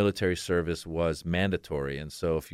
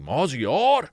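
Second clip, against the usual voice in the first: higher, louder call of the name; dad yelling name; 7 semitones higher, 5 dB louder than usual. Retold or imitated. Comedian imitating his dad yelling his name, louder. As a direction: bellow the name like a stern dad. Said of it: "yell your name really loud".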